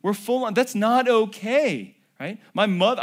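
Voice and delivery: normal talking voice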